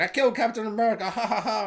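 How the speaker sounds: fast, high, smug villain boast with fake laugh; mock gloating villain. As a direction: ham up a cartoon baddie bragging. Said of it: "and he's like".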